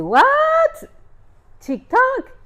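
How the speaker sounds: high, loud incredulous echo; stunned, high-pitched double take. Retold or imitated. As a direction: shriek it upward in disbelief. Shocked at meeting on TikTok.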